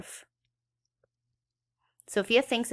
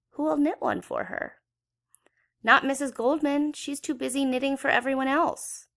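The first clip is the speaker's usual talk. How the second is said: put-on higher young girl's voice, fretting; child's fretting; 7 semitones higher than usual. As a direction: lift it like a worried little girl. Sophia's inner worried voice.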